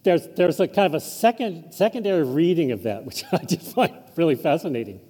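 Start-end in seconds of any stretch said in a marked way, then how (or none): none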